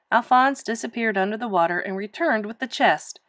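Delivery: ordinary delivery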